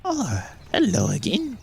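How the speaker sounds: put-on high, slow, airy old gnome voice; sing-song fortune teller hello; a high gnomish woman drawing out oh; gnome palm reader greeting. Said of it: "and she goes".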